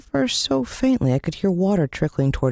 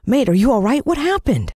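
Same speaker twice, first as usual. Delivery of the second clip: worried; 9 semitones higher than usual. A narrator's reading. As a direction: rush the worried questions. Boy rushes to hurt friend.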